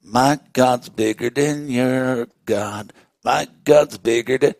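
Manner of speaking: low, slow sing-song boast; teasing, drawn-out one-upmanship; playful taunt. Acted. Imagined childish boast of Daniel.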